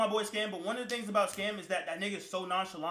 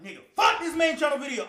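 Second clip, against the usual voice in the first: loud angry yell, pitched up; retold shout; 7 semitones higher, 5 dB louder than usual. Retelling the moment he snaps, shouting. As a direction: yell it like he's losing it. Yelling about the video in story.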